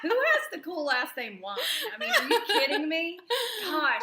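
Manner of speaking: laughing. Real, not acted. Laughing disbelief at friend's name.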